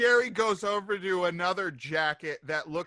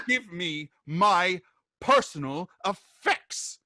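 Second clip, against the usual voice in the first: slow, louder, word-by-word order; emphatic, raised-voice insistence; furious demand; 2 semitones higher, 4 dB louder than usual. Acted. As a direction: space out each word as an ultimatum. Gretchen demands her buttons back.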